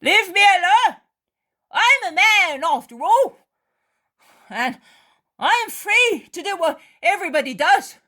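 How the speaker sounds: high, loud defiant yell; rude angry shout. Acted. Judas shouting at Mary to leave him. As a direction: throw her off, shouting. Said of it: "says Judas rudely".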